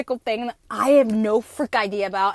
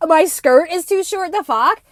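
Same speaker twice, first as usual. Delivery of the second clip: "My skirt is too short" said louder, pushing hard on "too"; indignant complaint; 5 semitones higher, 8 dB louder than usual. Retold or imitated. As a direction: protest to the prison guards, outraged. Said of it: "I was like, complaining".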